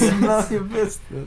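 high laughing repeat; laughing pun. Acted.

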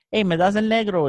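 put-on high, bright childlike ask in Spanish; kid asking for crayon. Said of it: "you hear a kid say".

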